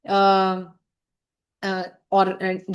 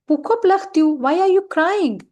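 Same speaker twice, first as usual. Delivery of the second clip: raised, louder worried question; Paul asking; 7 semitones higher, 5 dB louder, much slower than usual. Acted. Paul asks a crying friend why, in a raised concerned voice. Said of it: "ये Paul ने directly पूछा ऐसे".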